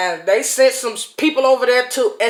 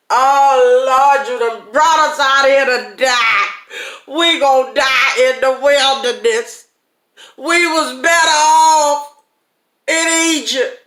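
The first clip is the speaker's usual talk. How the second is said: louder, higher whining complaint; 4 semitones higher, 5 dB louder than usual. Retold or imitated. Preacher voicing the grumbling multitude, whiny and loud. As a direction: whine like a crowd that wants to go back.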